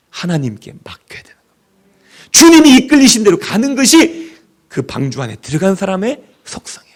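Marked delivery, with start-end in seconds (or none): 0.1-2.3 whisper. Acted. Whispered conclusion.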